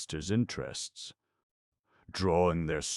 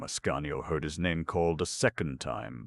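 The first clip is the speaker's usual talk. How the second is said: ordinary delivery, nothing marked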